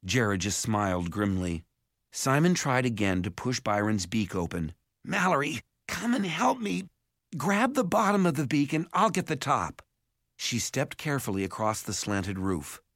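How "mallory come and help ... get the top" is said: urgent request. A narrator's reading. Simon urgently asks for help.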